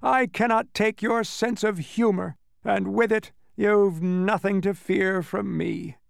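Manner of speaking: high demon voice, slowing into defeat; unhappy groaning. A narrator's reading. Demon conceding he is powerless, unhappy groan. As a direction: groan the admission, high, drawing out the end. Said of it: "he groaned unhappily".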